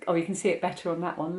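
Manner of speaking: normal talking voice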